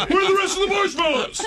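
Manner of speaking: high, loud comic bear demand. Retold or imitated. Bear demanding marshmallows from the man, comic outraged yell. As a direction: yell it as the greedy bear, half-laughing.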